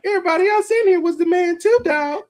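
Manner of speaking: very high-pitched put-on voice; Tyrone Williams. Veteran warning a humbled newcomer, high-pitched imitated voice. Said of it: "Like Tyrone Williams told me one time".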